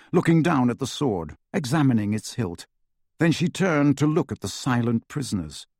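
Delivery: usual speaking voice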